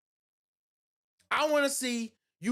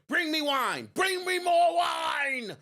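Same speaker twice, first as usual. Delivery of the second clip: higher, louder, slow drawn-out demand for wine; imperious wine demand; 8 semitones higher, 3 dB louder, slower than usual. Retold or imitated. She imagines the losing candidate, sulking, calling for wine loudly. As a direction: play a sulking queen calling for more wine.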